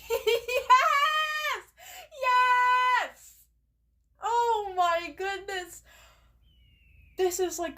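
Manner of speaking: real triumph bursting out; ecstatic cheer. Real, not acted. Villain finally exiled, celebrating.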